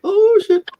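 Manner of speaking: sudden high, loud startled curse; mock fright. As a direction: yelp it like a jump scare. Moment the ghost appeared.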